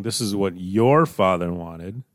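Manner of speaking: YOUR drawn out and punched, rising at the end; slow down and hit "your"; stress on YOUR. Shown as a demonstration. Preacher showing how the appeal lands on Joseph, stress on YOUR. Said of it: "it places the emphasis on Joseph".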